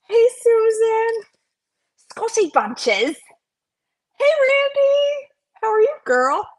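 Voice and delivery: sing-song hellos to viewers; high sing-song greetings. Real, not acted. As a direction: call out each name high and bright. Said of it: "I don't know why I'm talking like that".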